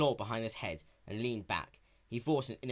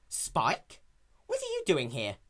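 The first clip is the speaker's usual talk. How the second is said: raised, brash tomboy pony voice; lightly raised young heroine; Rainbow Dash voice; 5 semitones higher, slower than usual. Acted. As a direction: play her sporty and cocky.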